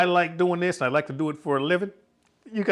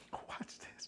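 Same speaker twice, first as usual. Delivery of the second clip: a hushed, breathy aside to the camera; whisper; 27 dB quieter than usual. Real, not acted. Husband whispering to viewers while his wife tastes. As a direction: whisper so she won't notice. Sneaking up to film her face.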